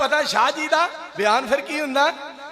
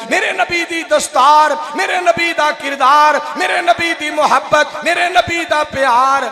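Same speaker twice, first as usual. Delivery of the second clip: high, loud rising refrain; preaching at full force; 6 semitones higher, 5 dB louder than usual. Real, not acted. Preacher lifting the crowd with rising praise. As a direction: call out each line to the crowd.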